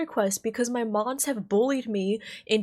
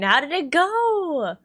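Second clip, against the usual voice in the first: ordinary delivery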